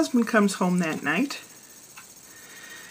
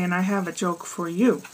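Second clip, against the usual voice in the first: normal talking voice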